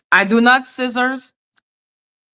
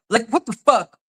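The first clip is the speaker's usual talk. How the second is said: ordinary talk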